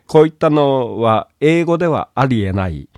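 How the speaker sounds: ordinary delivery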